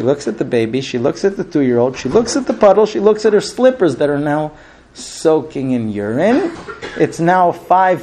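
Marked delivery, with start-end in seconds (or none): none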